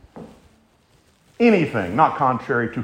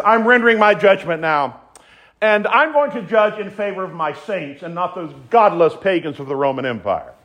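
high, raised proclaiming voice; lightly acted divine ruler pronouncing a verdict; declaring judgment; 7 semitones higher than usual. Voices the Father passing judgment.

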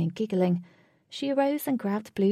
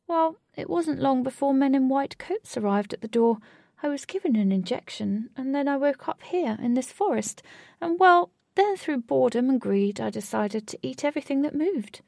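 higher-pitched, animated cat storyteller; talking cat; 6 semitones higher, 3 dB louder than usual. A narrator's reading. Cat explains how it ended up in the forest, animated. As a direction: play a giant cat telling its tale in a lifted voice. Giant cat recounts its past.

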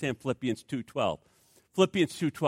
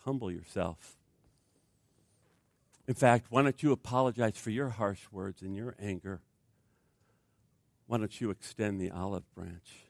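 quiet Spirit; 9 semitones lower, 8 dB quieter than usual. Acted. Spirit voiced, quiet urging.

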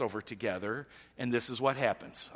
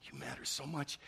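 soft, tender reassurance; loving; 2 semitones higher, 9 dB quieter than usual. Acted. Jesus looking in your eyes, speaking lovingly. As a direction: say it very quietly, with love. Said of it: "just so lovingly say".

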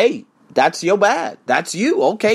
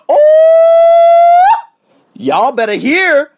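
much higher, louder drawn-out "Oh", then a slow "y'all better hear"; mock squeal; 12 dB louder, much slower than usual. Answering his friend who quit her job, in a mock high squeal. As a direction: shriek the "Oh" in mock disbelief.

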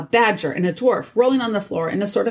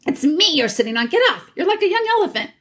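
high, squawking complaint; indignant and pitched far up; angry; 10 semitones higher, faster than usual. A narrator's reading. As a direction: protest crossly at being squashed. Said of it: "came an angry voice".

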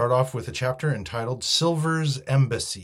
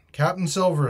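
higher, projected announcement; shouting; 7 semitones higher, 6 dB louder than usual. A narrator's reading. Mutineer announces his new captain to the stockade, shouting. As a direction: call out the name formally. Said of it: "he shouted".

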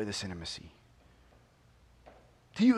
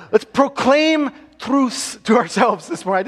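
put-on slow, loud Scottish accent, higher; preacher's playful Scottish accent; 9 semitones higher, 14 dB louder than usual. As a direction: lean into the Scottish accent on purpose. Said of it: "I did it again".

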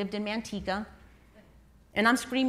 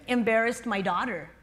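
ordinary talk, nothing marked

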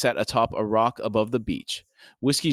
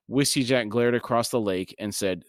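usual speaking voice

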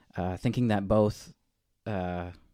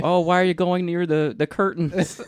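mock panic; 9 semitones higher, 7 dB louder than usual. Mocking panicked college kid.

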